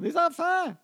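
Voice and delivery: high-pitched mimicked dinner call. Mother repeats supper call to silent kids, high mock holler. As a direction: call kids from afar, in imitation. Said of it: "Elle crie".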